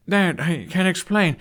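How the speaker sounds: Hiccup panicking. Acted. Hiccup blurts as father charges.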